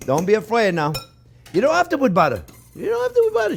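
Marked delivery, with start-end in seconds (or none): 2.8-3.5 much higher, playful sing-song; high teasing echo of the same line; squeaky high repeat. Shown as a demonstration. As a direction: jump way up high to tease. Repeats reassurance to viewers.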